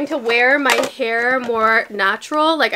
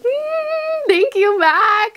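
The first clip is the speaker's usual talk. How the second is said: 1 excited thanks; 11 semitones higher, much slower than usual. Real, not acted. Thanking brand.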